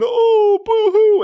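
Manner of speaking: very high, loud mock crying; hammed-up bully wail; mocking boo-hoo. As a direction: sneer like a bully fake-sobbing.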